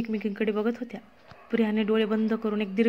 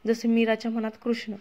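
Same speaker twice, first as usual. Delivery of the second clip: plain reading voice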